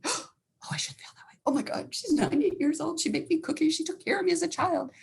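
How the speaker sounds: high, remorseful self-reproach; acted guilty backtracking. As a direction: gasp out the regret, starting whispery, then high. Acting out sudden guilt after venting.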